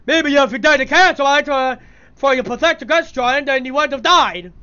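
a mild mock row between two parents; quarrelling game characters. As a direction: ham up a bickering cartoon argument.

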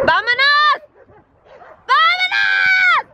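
high, loud shout, last one drawn out slowly. Real, not acted. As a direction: yell for the dogs to come. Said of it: "Yo les grité muy, muy, muy fuerte". (grito agudo y alargado)

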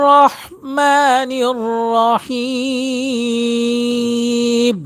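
রহমানির রহিম chanted slowly with a full, heavy র. Shown as a demonstration. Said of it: "তাহলে উচ্চারণটা হবে কি".

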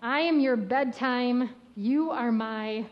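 gentle picture-book read, lines often slow and lifted; reading to children. Acted. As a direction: read it like a bedtime story to a little one.

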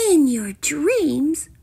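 slow, high, drawn-out scorn; scornful princess. A narrator's reading. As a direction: draw it out with high disdain.

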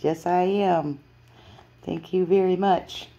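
loud, slow proud cat. Acted. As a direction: answer loudly and slowly like a proud cat. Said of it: "She says".

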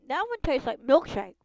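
loud, delighted verdict; excited. Real, not acted. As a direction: rave about the taste.

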